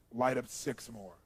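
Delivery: slow, unhurried instruction; calm order. Retold or imitated. Therapist springing a bigger demand, calm. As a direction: state it slowly, perfectly matter-of-fact. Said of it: "I'd act really calm again".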